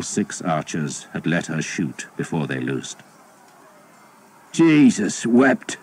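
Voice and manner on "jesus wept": weary oath. A narrator's reading. Archer swears at failed attack.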